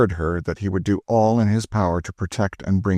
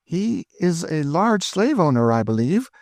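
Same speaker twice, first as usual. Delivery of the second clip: betraying interest; 11 semitones higher than usual. A narrator's reading. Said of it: "manner which seemed to betray more interest".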